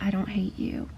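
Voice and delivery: soft hushed whisper; whispered line. Acted. Heroine tells Warner she doesn't hate him, whispered. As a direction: breathe it like a confession.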